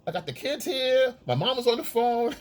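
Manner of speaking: high, pleading excuse; high, whiny excuses; mock excuse voice. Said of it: "You go".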